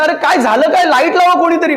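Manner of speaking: high urgent calling out; alarmed mom. Retold or imitated. Mom calling in the dark, alarmed. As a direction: demand the lights, rattled.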